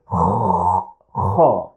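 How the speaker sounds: Kha rasped low in the throat; a harsh, scraping Kha. Shown as a demonstration. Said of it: "the sound comes from the throat".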